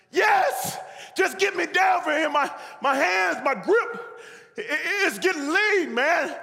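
very high, loud begging; frantic pleading shout. Acted. Man losing his grip begs the voice, frantic shout. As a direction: holler as the grip slips.

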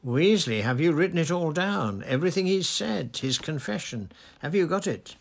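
loud, high, excited questioning; gleeful urgency, voice pitched well up; quivering with delight. A narrator's reading. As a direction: fire off questions in eager triumph. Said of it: "now positively quivering with delight".

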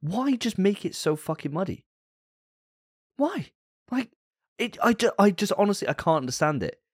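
incredulous at the muddy look, voice climbing; seething. Real, not acted. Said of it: "I am seething right now".

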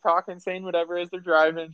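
ordinary delivery

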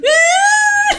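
high shrieking laugh; real laughing shriek. Real, not acted. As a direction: burst into a squealing laugh. Recalling sisters crying.

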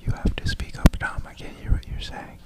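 insistent, pleading whisper; mock whisper. Whispers pleas to speak up.

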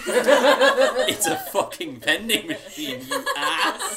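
loud exasperated laughing shout; laughing yell. Real, not acted. DM exasperated at player.

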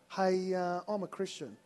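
ordinary talk, nothing marked

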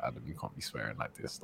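low, soft note to self; quiet self-reproach. Retold or imitated. Speaker tells himself off with kids present, low quiet reproach. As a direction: drop it low and soft, to yourself.

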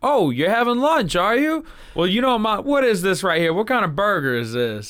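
a playful threat dressed as small talk; Jules menacing. Retold or imitated. To the scared guys, a lightly voiced Jules. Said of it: "just being like".

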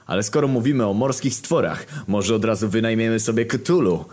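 plain reading voice

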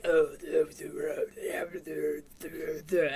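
low mumbled demon gibberish; Giles. Retold or imitated. Retelling Giles as a demon talking to Xander, low gibberish. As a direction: babble low, like a demon language. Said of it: "he's like".

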